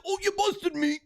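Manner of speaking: high squeaky caught-out voice; sheep caught out. Retold or imitated. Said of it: "he's like".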